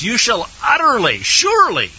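high, hard stress on UTTERLY and SURELY; imperative. Acted. Teacher reading out a commandment against idolatry, imperative. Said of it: "it's pretty specific and imperative".

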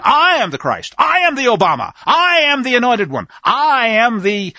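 each "I" punched high and loud; self-proclaiming claims, leaning hard on every "I"; emphatic I. Shown as a demonstration. Preacher mimics antichrists claiming the title, emphatic "I". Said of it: "I'm putting the emphasis on the syllable".

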